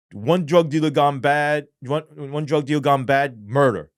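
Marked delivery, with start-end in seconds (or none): none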